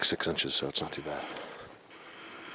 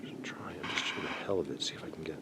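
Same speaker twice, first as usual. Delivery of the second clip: whispering; 2 semitones lower, 19 dB quieter than usual. Real, not acted. Quietly trying to film something.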